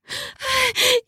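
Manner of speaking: sharp high gasp of pleasure; gasp, caught off guard. Acted. Woman gasping at her lover's move, high.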